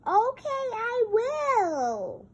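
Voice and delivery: high eager pony voice; Pinkie Pie voice. Pretend. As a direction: answer as an eager little pony.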